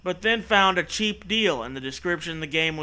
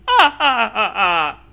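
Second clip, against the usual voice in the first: helpless laughter; 4 semitones higher, 6 dB louder than usual. Real, not acted.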